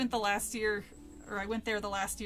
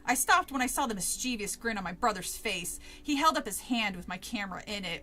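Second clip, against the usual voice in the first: reading the book aloud, lightly acted; story reading; 2 semitones higher, 4 dB louder than usual. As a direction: read the passage like a storyteller. Reads book passage aloud.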